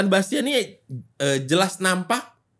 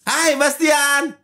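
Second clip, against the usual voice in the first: mock interviewer; 7 semitones higher, much slower than usual.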